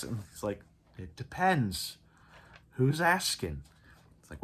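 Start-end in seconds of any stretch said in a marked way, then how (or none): 1.0-3.6 a hint of a cocky clone captain, slow on the first words; lightly voiced, teasing the strangers; sly Gregor voice. Gregor voiced in episode recap.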